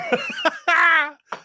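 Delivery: high-pitched burst of laughter; long, high real laugh. Real, not acted. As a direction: crack up at your own story. Laughing at his own story.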